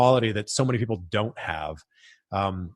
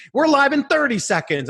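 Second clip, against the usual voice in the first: higher, more urgent countdown in the earpiece; urgent producer countdown; 11 semitones higher than usual. Retold or imitated. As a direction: push "thirty" up, the clock is running out. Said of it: "my producer's like".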